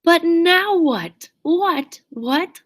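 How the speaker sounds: usual speaking voice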